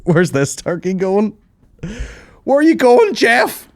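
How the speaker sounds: high, loud turkey squawk, building to a shriek; calling out in alarm. Acted. Turkeys watching one get taken away, calling out shrilly.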